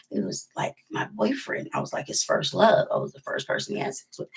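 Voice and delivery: hushed, breathy confession of a secret; whispered secret. Real, not acted. Telling listeners about her first love, in a hushed whisper. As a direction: whisper it so nobody else hears.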